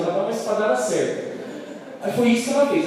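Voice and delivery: ordinary delivery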